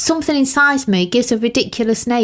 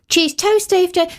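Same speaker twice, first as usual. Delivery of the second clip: higher, lightly acted café call; calling out order; 7 semitones higher than usual. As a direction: announce the food order to the room.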